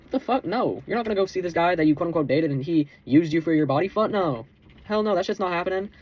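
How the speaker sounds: high, outraged no; incredulous refusal. Retold or imitated. To his girlfriend, high outraged refusal. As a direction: shut the idea down flat out.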